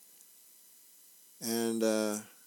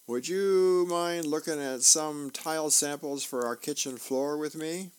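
higher wifely call from another room; calling from offscreen; 5 semitones higher than usual. Acted. Wife calls husband from out of the room, calling. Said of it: "a voice from off the screen".

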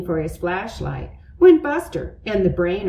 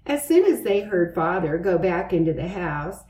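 ordinary delivery

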